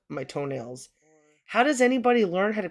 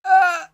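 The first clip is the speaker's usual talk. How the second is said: sudden high yelp of pain; sharp cry of pain; 12 semitones higher, 10 dB louder than usual. Real, not acted. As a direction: yelp as the clipper pinches. Toenail clipping hurts her toe.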